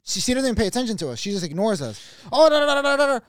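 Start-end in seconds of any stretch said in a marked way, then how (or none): 2.3-3.2 high, loud blathering lady; imitated nonstop gabbing; mock chatter. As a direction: babble on obliviously in a high voice. Mimics lady chattering, ignoring them.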